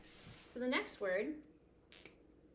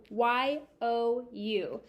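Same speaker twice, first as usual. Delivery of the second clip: slow spelling; 4 dB louder, much slower than usual. Shown as a demonstration. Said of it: "spelling aloud slowly".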